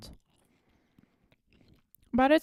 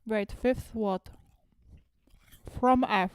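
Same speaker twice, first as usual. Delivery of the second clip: talking through a mouthful; chewing while speaking; mouth full. Real, not acted. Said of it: "I'm talking with my mouth full".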